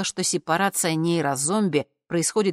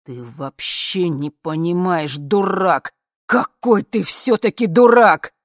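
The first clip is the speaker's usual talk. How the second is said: slow build from tense to loud; mounting, drawn-out angry outburst; shaking with fury; 2 semitones higher, 7 dB louder, slower than usual. A narrator's reading. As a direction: stretch it out, swelling into an exasperated yell. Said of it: "А буквально трясло".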